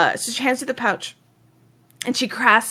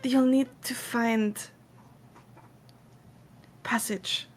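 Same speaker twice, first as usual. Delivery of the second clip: soft, halting plea; softly, with pauses between phrases; earnest quest giver; 9 dB quieter than usual. Acted. Woman hiring adventurers to find someone, soft and halting.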